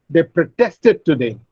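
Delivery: "protested" stressed on -TEST-; verb stress. Shown as a demonstration. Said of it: "shift the stress on the second syllable".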